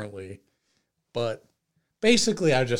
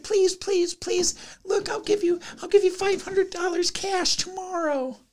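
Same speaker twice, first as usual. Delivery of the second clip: much higher, frantic begging voice; mock desperate pleading. Driver begging the angry man not to call police, high frantic pleading. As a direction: beg him like your life depends on it. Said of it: "I'm like".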